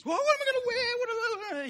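put-on high, squeaky panicked girl; panicky. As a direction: fret in a high squeak about what to wear.

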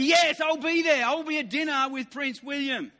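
eager yes. Retold or imitated.

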